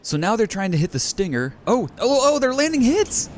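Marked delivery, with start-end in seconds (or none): 1.7-3.3 high, loud rising excitement; thrilled cheering at the hits; excited. Real, not acted. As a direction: get louder and higher with each oh. Watching hits land.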